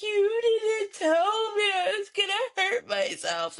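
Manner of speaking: put-on high, slow child's whine; whiny hurt child. Toddler whining to mom after hitting his head, high and slow. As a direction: play the crying toddler blaming mom.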